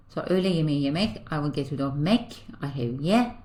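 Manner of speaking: usual speaking voice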